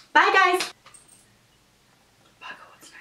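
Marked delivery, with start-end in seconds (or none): 2.4-2.9 unvoiced muttered swear; whispered curse. Real, not acted. Forgetting the lyrics between takes, whispered curse.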